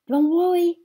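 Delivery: high, very loud shout. A narrator's reading. Sweetie Pie shouts at the party guests, very loud. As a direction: yell it reassuringly over the rain. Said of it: "she shouted".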